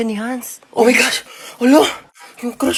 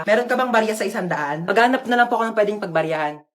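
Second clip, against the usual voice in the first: ordinary delivery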